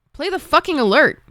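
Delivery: normal talking voice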